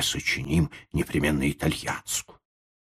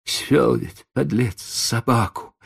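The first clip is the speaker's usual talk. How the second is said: plain reading voice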